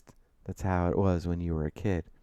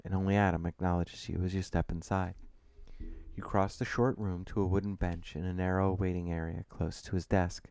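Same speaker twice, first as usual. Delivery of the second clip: usual speaking voice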